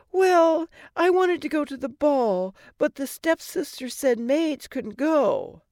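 a frightened princess asking who's there; startled Cinderella. Acted. Cinderella explains wish.

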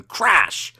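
high, loud crash; crash sound effect. Acted. Reader voices a creature bursting through the wall, loud crash. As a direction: bang the word out like a wall breaking.

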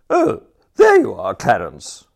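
Lady Constance's voice. A narrator's reading. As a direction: call out to him sharply from above. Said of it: "she said".

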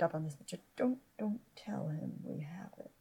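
hushed whisper to a friend. Acted. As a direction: keep it low so he can't hear. Secret talk aside from Malachi.

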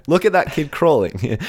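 put-on high-pitched old-time exclamation; turn-of-the-century coach. Amazed coach at a turn-of-the-century carnival, high exclamation. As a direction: have a go at an old-fashioned coach calling out. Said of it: "What would someone at the turn of the century sound like?".

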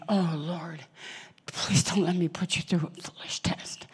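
whispered silent prayer; hushed inward prayer; inner prayer whisper. Retold or imitated. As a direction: whisper it as a private plea to God. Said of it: "inside just cringing and saying".

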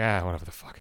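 low, very soft brush-off; retold dismissive mutter. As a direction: mumble it away, not caring. Retold dismissal of call while grieving.

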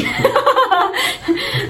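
high-pitched laughing fit; helpless high laugh; real burst of laughter. Real, not acted. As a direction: break up at your own joke. Laughs at own joke about friend.

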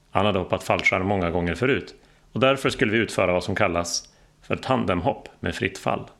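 ordinary delivery

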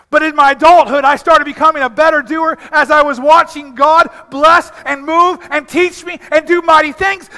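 loud, high preaching build; fervent sermon, voice climbing; preaching at full force. Acted. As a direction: lift the congregation with it. Preaching climax.

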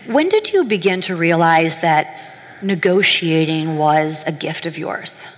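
ordinary talk

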